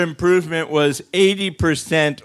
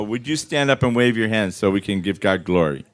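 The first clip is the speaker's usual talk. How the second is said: ordinary delivery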